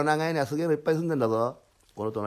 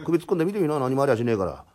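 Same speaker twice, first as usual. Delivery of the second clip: usual speaking voice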